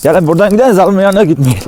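coaxing dogs to come along; calling dogs. Real, not acted.

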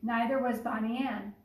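usual speaking voice

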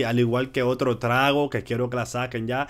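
ordinary talk, nothing marked

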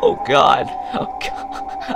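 high-pitched pleading through laughs; real laughing fit, words breaking up; helpless laughter. Real, not acted. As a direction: crack up while begging it to stop. Laughing at freaking-out character.

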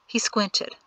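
plain reading voice

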